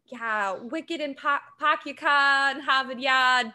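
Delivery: lightly put-on Boston accent for a quip; a hint of Boston, dropped r's. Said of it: "over-the-top Boston accent".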